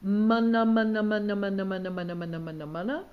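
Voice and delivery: "mana" repeated loudly with firm lip closure, fading; lip drill. Shown as a demonstration. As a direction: bounce the lips on each "mana". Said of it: "another warm-up exercise for the lips".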